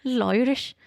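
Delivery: mock Irish accent. Shown as a demonstration. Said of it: "That's all I can say".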